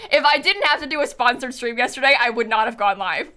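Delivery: ordinary talk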